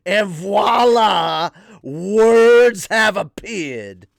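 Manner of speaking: loud, slow, high showman-preacher; screaming preacher. Acted. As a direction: proclaim it with stretched words. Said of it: "at least you're not screaming".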